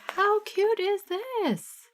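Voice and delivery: very high, very slow squeal over something cute; high, stretched-out delight; gushing. Real, not acted. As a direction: coo at how adorable it is.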